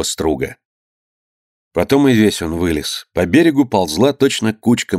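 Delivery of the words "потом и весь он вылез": ordinary delivery, nothing marked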